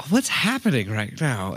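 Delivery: slow, mock-bewildered question. Bewildered retold reaction to the guy.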